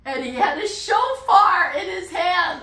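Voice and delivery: loud, high, laughing exclamation; elated high-pitched reveal; real delighted excitement. Real, not acted. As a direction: burst out with it, breaking into laughter. Delighted climax, breaking into laughter.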